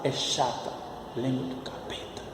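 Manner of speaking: confidential near-whisper. Pretend. Teacher confides that Portuguese is annoying, in hushed voice. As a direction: drop right down, like a secret aside. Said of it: "falando mais baixo".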